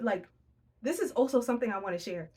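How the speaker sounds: usual speaking voice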